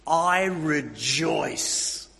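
quoting Paul with slow, firm conviction. Acted. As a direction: say I rejoice slowly, with conviction. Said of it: "absolute conviction".

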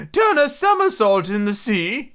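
high, slow excited turtle cry. A narrator's reading. Mock turtle calling dance steps, crying out. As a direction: call it out high and drawn-out. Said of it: "cried the mock turtle".

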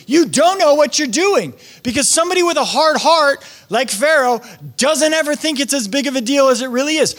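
normal talking voice

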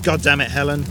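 a loud, furious curse; raging. Acted. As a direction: curse her out in rage. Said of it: "he raged".

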